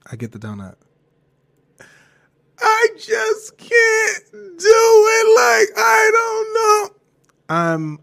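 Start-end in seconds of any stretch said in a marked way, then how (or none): 2.6-7.0 high, slow, loud sobbing shout; crying wail. Retold or imitated. Breaking down over a donut, high sobbing wail. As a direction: break down wailing. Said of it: "I'm crying hysterically".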